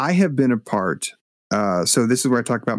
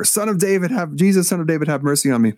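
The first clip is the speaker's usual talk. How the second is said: pitched up, fast repeated plea, a mild mock call; calling out again, more urgent than the first time; retold louder cry; 7 semitones higher, faster than usual. Blind man calling to Jesus over the crowd, mild mock louder cry. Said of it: "but he does it louder".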